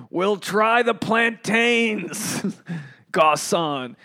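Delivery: drawn-out, high, hammed-up royal order; mock king. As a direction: play a grand diner summoning the waiter. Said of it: "I just became, like, a king".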